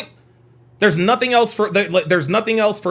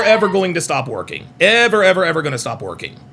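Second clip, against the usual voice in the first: normal talking voice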